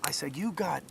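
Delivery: soft, halting pronouncement; hushed hand reading. Retold or imitated. Reads a stranger's hand at a bar, hushed. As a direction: say it low-key, pausing as the insight comes.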